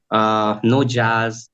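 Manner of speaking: ordinary delivery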